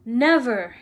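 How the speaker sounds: booming loud "Never" from the giant; enraged giant roaring at the uncle; thundered. A narrator's reading. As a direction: hurl it like thunder. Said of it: "he thundered".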